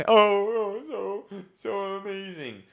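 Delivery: a lightly hammed-up fake weep; fake crier. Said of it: "best fake crier".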